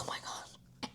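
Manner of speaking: barely audible, awed whisper; overwhelmed whisper. Retold or imitated. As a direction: whisper it as if near tears. Almost tearing up, overwhelmed.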